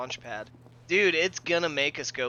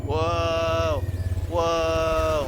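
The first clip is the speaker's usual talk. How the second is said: excited whoa; 7 dB louder than usual. Real, not acted. Reacting to game twist.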